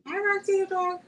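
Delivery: high pet-greeting voice; greeting a dog. Real, not acted. Owner greeting her dog Roxy, high pet voice.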